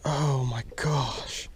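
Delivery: slow, hushed oh my gosh in disbelief; stunned hush. Real, not acted. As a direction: breathe it out, stunned by the miss. Fish lost, stunned disbelief.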